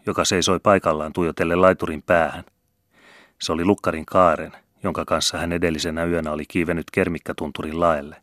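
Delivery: ordinary delivery, nothing marked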